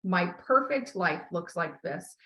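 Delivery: very slow, deliberate, every word placed; slow declaration. Shown as a demonstration. Coach modelling the title of the life list, very slow and deliberate. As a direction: read the title out slowly like a vow. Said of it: "it should say".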